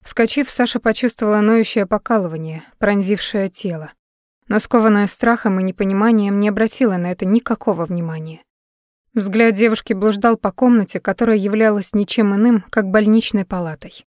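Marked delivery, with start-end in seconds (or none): none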